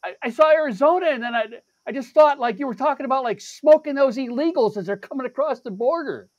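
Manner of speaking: lightly voiced stranger explaining himself; defensive stranger. Stranger in line explaining his guess, defensive.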